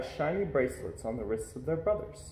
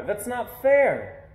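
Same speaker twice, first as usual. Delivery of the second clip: slow, louder, higher complaint; upset protest; 7 semitones higher, 6 dB louder, much slower than usual. A narrator's reading. Boy complains to his parents about the wait, slow and loud. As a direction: drag it out like a hurt kid. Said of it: "I cry".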